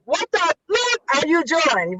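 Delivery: high, slow mock challenge; emphatic confrontation. Showing what she says to the guys, emphatic confrontation. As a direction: draw the words out in exaggerated disbelief.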